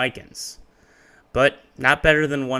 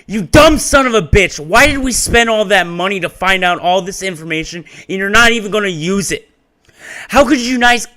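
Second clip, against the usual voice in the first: furious high shout; screamed; 9 semitones higher, 12 dB louder than usual. A narrator's reading. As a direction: scream it at him in a rage. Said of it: "He screams the Mikan".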